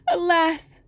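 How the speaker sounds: put-on young girl's high wail; despairing maiden. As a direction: cry out your hopelessness. Maiden laments her fate.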